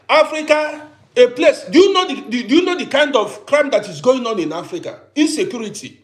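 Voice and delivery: almost shouting it at the doubters; heated outburst. Real, not acted. Vlogger scolding viewers about crime in Africa, heated and loud. Heated rant against investing.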